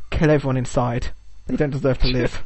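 low, soft menacing order; sinister announcer. Retold or imitated. Show hosts ordering the contestants killed, low menace. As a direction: coldly condemn them all. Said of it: "they're like".

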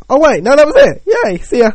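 plain start then jumps high and loud; going from Pooh's sigh to sudden cheer; sudden excitement. Pretend. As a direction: drop the bother, burst into glee. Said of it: "and then got excited".

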